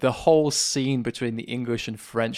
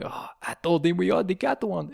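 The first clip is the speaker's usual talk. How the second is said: higher, put-on French accent; comic French accent, pitched up; mock French accent; 6 semitones higher than usual. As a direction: quote the taunting Frenchman in his French accent. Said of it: "insults by the French".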